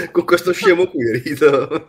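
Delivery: loud laughing protest; high laughing outburst. Real, not acted. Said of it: "che cazzo vi ridete?".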